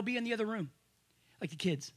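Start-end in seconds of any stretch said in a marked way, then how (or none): none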